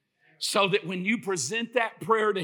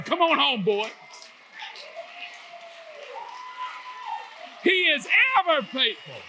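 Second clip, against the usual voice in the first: loud, higher, shouting praise; preaching at full force; 8 semitones higher, 5 dB louder than usual. Acted. Preacher declaring God's faithfulness to the congregation, shouting. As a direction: build it up and hammer every line.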